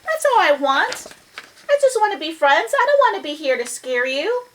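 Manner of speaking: high-pitched, pleading mouse voice; little mouse. Acted. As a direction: ask hopefully to be friends. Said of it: "So Mouse is like".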